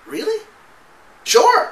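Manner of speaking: hammed-up shock at good news; mock stunned delight. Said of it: "I was like".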